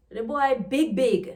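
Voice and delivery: Jamaican patois, "big" said twice for emphasis; in Jamaican patois, lean on the repeated "big". Shown as a demonstration. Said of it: "repetition of adjectives for emphasis".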